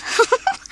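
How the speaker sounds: a high little giggle. Acted. Minnie giggles at the overloaded car. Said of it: "she giggled".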